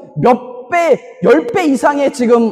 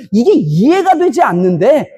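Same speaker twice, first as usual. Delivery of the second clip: slow, weighty stress on 이게 with a rise; slow emphasis; slower than usual. Real, not acted. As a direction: drag out 이게 and lift it at the end. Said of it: "sermon emphasis". (이게를 천천히 끌며 강조)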